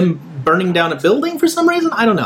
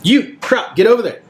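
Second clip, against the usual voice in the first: shocked double take; 6 semitones higher than usual. Acted. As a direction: jolt in surprise then bark. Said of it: "He does a double take".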